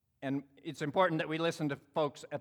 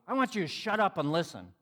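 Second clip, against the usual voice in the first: higher-pitched, angry demand; retold heated order, pitch raised; mad; 5 semitones higher, 4 dB louder than usual. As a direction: imitate an angry young man's outburst. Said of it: "He's still mad".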